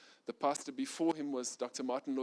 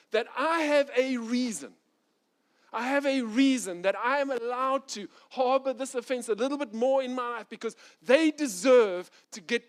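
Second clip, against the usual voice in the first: much higher and louder, heated self-justifying pleading; indignant protest; 9 semitones higher, 8 dB louder than usual. Retold or imitated. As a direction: insist on your grievance, rising to a near shout. Pastor protests his right to resentment.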